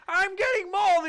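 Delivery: very high, loud comic yelling; hammed-up victim scream. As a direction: scream it like a cartoonish mauling victim. Acts man being mauled.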